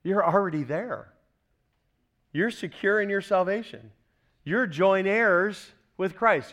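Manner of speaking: ordinary talk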